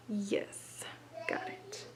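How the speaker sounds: whispered little victory; whispering. Real, not acted. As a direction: breathe it out in relief. Quiet triumph while working knot.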